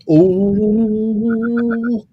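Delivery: long, loud, rising "ooh"; mock alarm. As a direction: draw out a teasing "uh-oh, trouble" sound. Teasing reaction to a warning read out.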